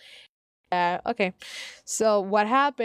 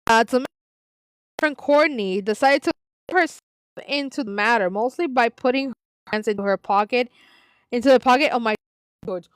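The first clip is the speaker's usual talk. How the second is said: ordinary delivery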